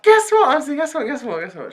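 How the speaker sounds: high, eager rush; excited news-breaking. Retold or imitated. Girl bursting to tell auntie the news, excited. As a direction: pester her with high-pitched excitement.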